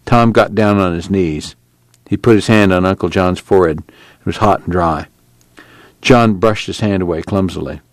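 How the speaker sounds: usual speaking voice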